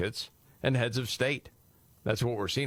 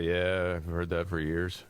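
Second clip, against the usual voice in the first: mildly dismissive, low-key; eye-rolling dismissal; 3 semitones lower, slower than usual. Acted. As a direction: say it with an understated eye-roll. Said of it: "do you just kinda roll your eyes".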